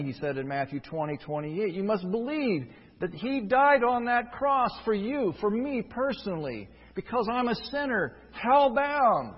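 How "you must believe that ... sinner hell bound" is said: impassioned preaching. Acted. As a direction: build the plea until it bursts out. Preacher pleads with the congregation.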